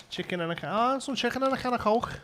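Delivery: put-on high-pitched broad Scouse accent. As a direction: put on thick Liverpudlian, pitched right up.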